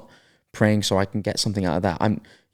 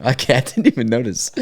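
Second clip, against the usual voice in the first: laughing; 4 semitones higher than usual. Real, not acted. Laughing after accent teasing.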